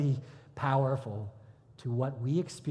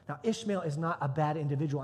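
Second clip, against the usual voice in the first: ordinary talk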